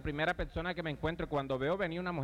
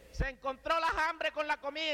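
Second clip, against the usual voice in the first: defiant challenge; 10 semitones higher than usual. Retold or imitated. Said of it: "El grito fue".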